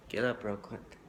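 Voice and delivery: ordinary talk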